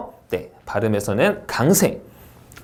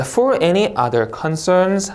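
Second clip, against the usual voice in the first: slow, higher phrase rising on "concerns"; rising intonation; 5 semitones higher, much slower than usual. Shown as a demonstration. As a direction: lift "concerns" as if more follows. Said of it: "하고 올려읽고". ("concerns" 끝을 올려 읽기)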